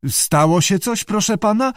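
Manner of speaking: high, uncertain question; hesitant stammer. A narrator's reading. Keeper asks his director what happened, unsure. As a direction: stammer it nervously upward. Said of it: "wyjąkał Greggs niepewnie".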